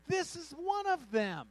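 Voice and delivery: high, loud, slow accusation; accusing servant girl. Acted. Servant girl telling bystanders about Peter, loudly accusing. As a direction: call him out to everyone, high and drawn out. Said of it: "the servant girl saw him and began to say".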